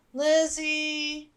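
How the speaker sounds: sleepy younger sister moaning. Acted. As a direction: whine a name half awake. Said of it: "Lydia moaned sleepily".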